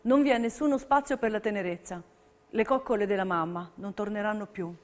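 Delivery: ordinary delivery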